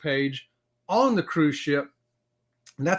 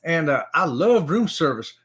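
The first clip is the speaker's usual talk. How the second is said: ordinary talk, nothing marked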